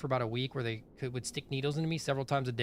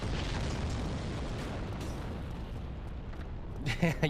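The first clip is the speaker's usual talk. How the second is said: breathy laugh; 2 semitones higher, 5 dB quieter than usual. Real, not acted. Laughing at own joke.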